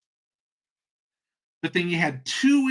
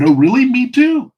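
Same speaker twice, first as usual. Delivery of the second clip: loud, hammed-up thrill, leaping higher; mock overjoyed agreement; 9 semitones higher, 11 dB louder than usual. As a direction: exclaim like you found a long-lost brother. Joke's delighted common-ground reply, escalating.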